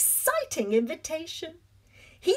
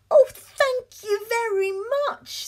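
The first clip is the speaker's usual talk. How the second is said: delighted thanks; 9 semitones higher, 3 dB louder than usual. Acted. Cake delighted with hat.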